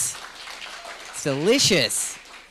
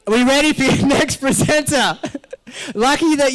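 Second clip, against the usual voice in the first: laughing announcement; 8 semitones higher, 3 dB louder than usual. Real, not acted. MC laughs while introducing speaker.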